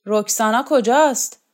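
forceful shouted question; shouting. A narrator's reading. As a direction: shout out for someone missing. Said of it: "راخوف فریاد کشید".

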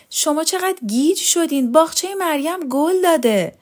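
high-pitched, insistent; excited exclamation. A narrator's reading. Woman to doubters about the flowerbed, excited insistence.

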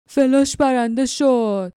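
slow, drawn-out cry of triumph in a gruff voice; excited roar. Acted. Glock cheers his spider's win with a mild excited roar. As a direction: crow over the winning spider, stretching it out. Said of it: "گلاک با هیجان نعره کشید".

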